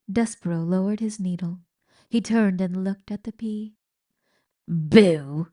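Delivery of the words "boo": loud jeering boo; shout. A narrator's reading. Old rat booing again. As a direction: heckle the mercy. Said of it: "shouted Botticelli again".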